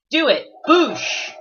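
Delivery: hyped shout. Real, not acted. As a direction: shout it like a battle cry. Hyped while switching to Iron Bull.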